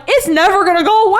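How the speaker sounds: high, quick exasperated cry; exasperated outburst. Retold or imitated. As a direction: blurt it out, fed up and high. Exasperated quote of own conclusion.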